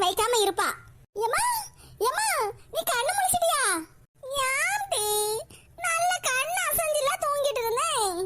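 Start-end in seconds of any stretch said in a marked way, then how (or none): 1.3-3.6 higher, urgent call repeated to the mother; eager call. Acted. Daughter calling again to the waking mother, higher and urgent. 4.5-8.2 put-on higher, whiny old mother's complaint; sleepy old mother. Old mother scolding her daughter for waking her, whiny and higher.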